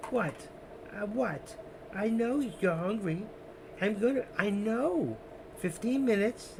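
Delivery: high pet-talk voice; cooing at the cat. Real, not acted. Owner soothing his hungry cat, high pet talk. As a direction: coo up high to calm the cat.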